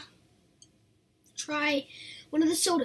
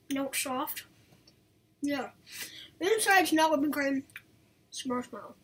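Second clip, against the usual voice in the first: talking between bites of sweets; chewing while talking. Real, not acted. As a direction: mouth partly full of candy. Seen 2: Bit treat at -7; mouth working, treat in hand throughout.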